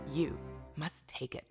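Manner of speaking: ordinary talk, nothing marked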